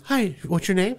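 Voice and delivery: lightly acted receptionist voice; imitated receptionist. Acting out the receptionist's greeting.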